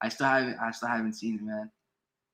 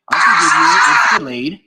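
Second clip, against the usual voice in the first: loud shouted concession; shouting; 2 semitones higher, 11 dB louder, faster than usual. Real, not acted. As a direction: yell it over the noise. Stream host shouting an argument.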